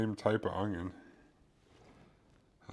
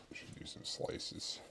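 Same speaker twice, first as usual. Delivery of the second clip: whispered; 8 dB quieter, slower than usual. Real, not acted. Cooking vlog, muttered aside.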